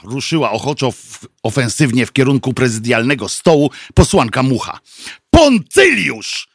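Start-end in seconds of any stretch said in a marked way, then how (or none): 5.3-6.5 high, drawn-out proclamation; eager call-out. A narrator's reading. MP proposes a candidate to the meeting, eager high call. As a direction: announce the name slowly and triumphantly.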